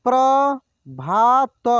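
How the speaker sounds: Prabhat drawn out slowly, syllable by syllable; slow sounding out. Shown as a demonstration. Teacher slowly sounds out Prabhat for students. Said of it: "एक एक मात्रा को अलग अलग करके".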